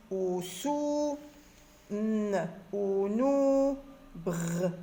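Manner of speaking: each sound drawn out separately, then joined with "ou" or "o"; teacher slowly blending sounds into syllables. Shown as a demonstration. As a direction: hold R, N, M, S, L, then blend into "rou", "nou", "lou". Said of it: "forme et lit des syllabes".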